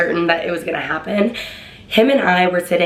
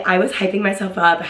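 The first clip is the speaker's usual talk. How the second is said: ordinary talk, nothing marked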